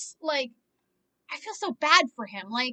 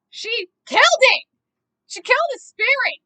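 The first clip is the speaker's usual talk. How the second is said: much louder and higher, the first slowed; outraged outburst; 9 semitones higher, 10 dB louder than usual. Real, not acted. Outraged at the woman who crushed the writer. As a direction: hit killed hard.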